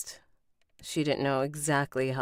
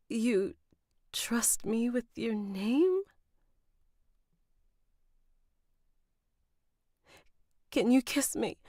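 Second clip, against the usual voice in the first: higher-pitched, halting, moved questions; tearful; 7 semitones higher, slower than usual. Acted. Heroine given her own company, mildly tearful. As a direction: ask slowly, pitch lifted, overcome with emotion. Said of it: "So she's all crying and stuff".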